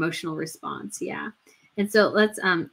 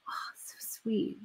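slow, breathy whispered awe; soft whispered tenderness; moved whisper; 13 dB quieter, slower than usual. Real, not acted. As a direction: whisper it, almost in tears.

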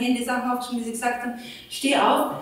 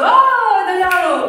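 Italiano with a laugh, higher and louder; light up with relief on Italiano; delighted Italiano; 8 semitones higher, 9 dB louder than usual. Real, not acted. She hears the husband speaks Italian, laughing with relief.

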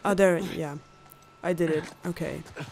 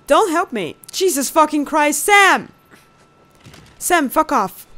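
high, loud exasperated shouting; annoyed; 7 semitones higher, 6 dB louder than usual. Real, not acted. Player to annoying brother in the way, angry shout. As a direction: snap loudly at a brother. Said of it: "This is so annoying".

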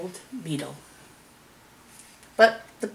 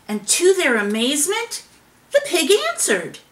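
ordinary delivery

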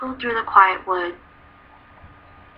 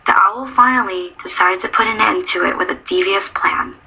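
ordinary delivery

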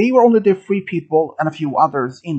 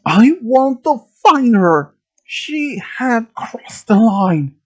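high, slow, loud demand; demanding screaming tone; 7 semitones higher, 4 dB louder, slower than usual. A narrator's reading. Bullied gunman explains his hunt, demanding screaming tone. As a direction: yell it out, dragging the words. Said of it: "he said demandingly in a screaming tone".

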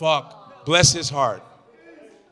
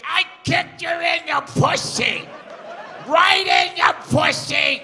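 much higher, slow shouted screech; Gilbert stage voice; 11 semitones higher, slower than usual. Retold or imitated. Comic imitating Gilbert's onstage screech, much higher and slow. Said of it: "when he got on stage, he's like".

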